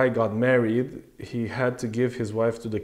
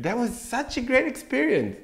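sarcastic gush; 13 semitones higher, 5 dB louder than usual. Pretend. Sarcastic gushing after Apple fail.

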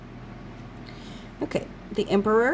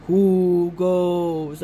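guard impression; 3 semitones lower, 5 dB louder than usual. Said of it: "that guy that says".

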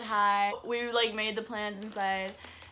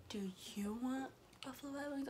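soft, breath-only whispered question; imitated whisper; 4 semitones higher, 12 dB quieter, faster than usual. Imitating Gio asking about food, whispering. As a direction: whisper it like a secret offer.

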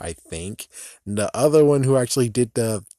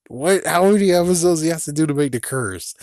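ordinary delivery

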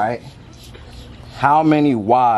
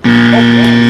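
loud groan; 2 semitones lower, 11 dB louder than usual. Real, not acted. Missed shot, groans loudly.

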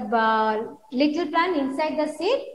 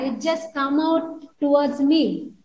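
ordinary talk

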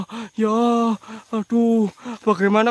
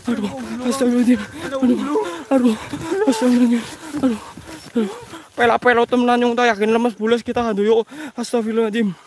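usual speaking voice

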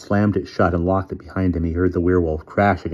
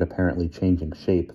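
plain reading voice

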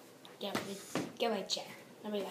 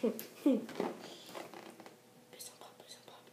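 whispering; 6 dB quieter, much slower than usual. Real, not acted. Pleading with the balloon.

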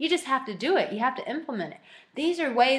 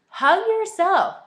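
excited urging; 8 semitones higher, 5 dB louder, slower than usual. Shown as a demonstration. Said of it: "demonstrating hugging herself".

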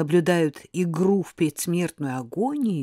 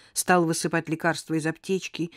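ordinary delivery, nothing marked

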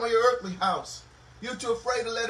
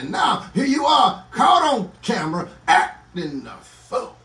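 driving, rhythmic preacher's delivery; impassioned preaching; 6 dB louder than usual. Real, not acted. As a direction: exhort the congregation with rising fervor. Preacher exhorting a congregation.

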